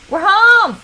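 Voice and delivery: high, loud happy announcement; cheerful shout. Real, not acted. Gamer announcing her Sim is home, cheerful. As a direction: call out the arrival.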